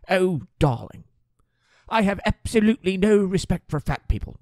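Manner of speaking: put-on high British accent, breezy old lady; British accent, tossing off an outrageous line. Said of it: "You never know what she's gonna say".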